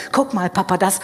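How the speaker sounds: voix un peu plus aiguë de petit garçon; enfant tout content. A narrator's reading. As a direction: jouer légèrement l'enfant qui montre sa trouvaille. Enfant accouru montre son trésor.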